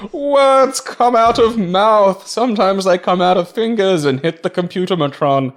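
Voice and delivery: slow, high mock-dimwit voice; inarticulate caveman-like talker. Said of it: "you can't talk".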